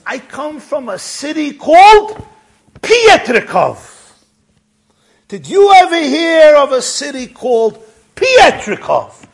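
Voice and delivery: high, loud, town name drawn out; emphatic loud questioning. Retold or imitated. Old man asking about his hometown, loud and insistent. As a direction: press the question home loudly.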